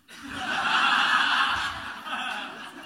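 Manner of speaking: real burst of laughter at his own joke; laughing out loud. Real, not acted. Preacher laughing at his wife's comeback, real laugh. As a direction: let a big laugh break out.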